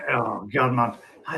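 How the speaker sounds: normal talking voice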